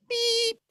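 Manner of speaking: very high bleep sound; high-pitched vocal bleep; mock censor beep. Self-censoring a rude word.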